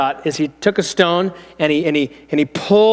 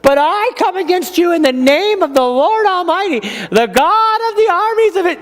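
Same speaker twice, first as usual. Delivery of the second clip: put-on high-pitched odd voice of young David; weird small-boy voice; 11 semitones higher than usual. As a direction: squeak it out like a small brave boy. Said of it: "He's gonna have a weird voice".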